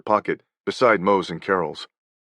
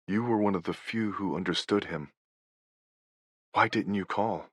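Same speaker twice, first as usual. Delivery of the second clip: much softer, tender pleading; soft plea; 8 dB quieter than usual. Retold or imitated. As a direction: say it gently, as the hurt beneath. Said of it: "what she's saying is".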